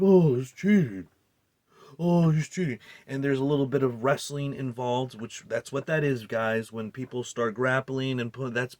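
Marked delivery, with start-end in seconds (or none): 0.0-2.8 mocking whiny critics, a bit louder; mocking whiners. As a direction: whine it like an outraged fan. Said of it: "and they're like".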